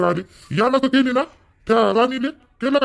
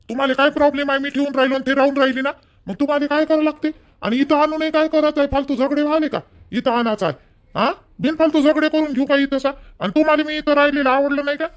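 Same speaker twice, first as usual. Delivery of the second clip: higher, heated scolding of the son; angry scolding; 6 semitones higher than usual. Acted. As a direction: snap at the son, raising your pitch. Father quarrels with his son.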